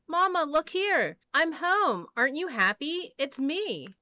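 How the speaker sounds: high, excited little piglet voice; crying out. A narrator's reading. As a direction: call excitedly to Mama, pitched well up. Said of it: "The piglet ran in crying".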